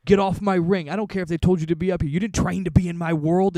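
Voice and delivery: worked-up quoted tirade; angry wrestler. Retold or imitated. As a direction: order someone out of your space, fast and forceful. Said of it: "he goes".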